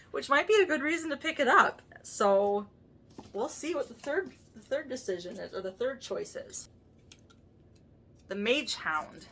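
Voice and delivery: ordinary talk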